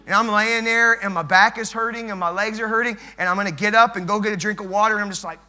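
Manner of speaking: usual speaking voice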